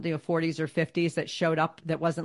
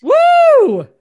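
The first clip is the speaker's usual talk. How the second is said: excited cheer; 13 dB louder than usual. Real, not acted. Cheering at the sign-off.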